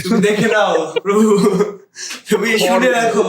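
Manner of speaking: mock prayer. Calling God to witness his friend's words, in mock prayer. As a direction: call on the Lord as a joke, half laughing. Jokingly calls the Lord to witness.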